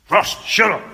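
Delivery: loud, high snapping teacher; angry teacher. Retold or imitated. Teacher scolding a chatty pupil, loud and sharp. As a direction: snap at the pupil like a cross teacher. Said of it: "the teacher".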